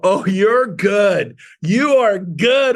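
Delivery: slow, drawn-out mock admiration; impressed admiration. Assistants catch on to his lunch trick, imitated impressed admiration. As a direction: stretch it out, knowingly impressed.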